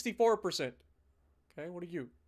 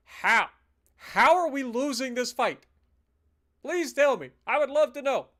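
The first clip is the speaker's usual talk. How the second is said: exasperated; 5 semitones higher, 5 dB louder than usual. Real, not acted. Losing an unfair battle.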